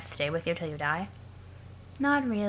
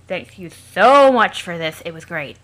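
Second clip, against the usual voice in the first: sarcastic; 11 dB louder, slower than usual. Acted. Sarcastic loud gratitude.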